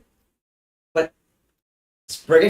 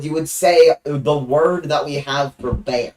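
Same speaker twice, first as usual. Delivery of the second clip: ordinary delivery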